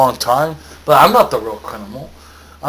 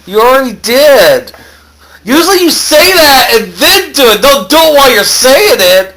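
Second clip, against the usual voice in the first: loud, high-pitched complaint; raised voice, pitch swooping high; indignant protest; 8 semitones higher, 7 dB louder than usual. Acted. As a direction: protest loudly at being hit too soon. Protesting after being punched.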